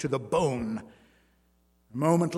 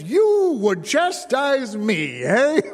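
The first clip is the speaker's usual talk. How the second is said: loud, higher, slow mocking shout; laughing roar; 9 semitones higher, 7 dB louder, slower than usual. A narrator's reading. Strongman mocks the dwarf, laughing roar. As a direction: bellow through laughter. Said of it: "He roared".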